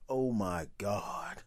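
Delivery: drawn-out, low and soft dismay; slow horrified oh my God. Retold or imitated. Seeing the filthy truck, slow and appalled. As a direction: stretch it out, appalled at the sight. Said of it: "looking at the truck going".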